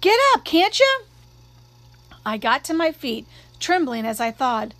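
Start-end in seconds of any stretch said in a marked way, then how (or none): 0.0-1.0 very high, loud yell; urgent scream, pitched way up. A narrator's reading. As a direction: shriek an exasperated order. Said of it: "Jim was screaming".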